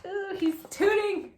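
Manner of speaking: high, slow, teasing; playful delight. Real, not acted. Reader teasing the children about the tooting dog. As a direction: relish the silly moment.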